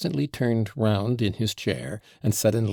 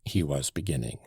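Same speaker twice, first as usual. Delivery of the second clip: usual speaking voice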